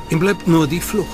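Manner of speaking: ordinary talk, nothing marked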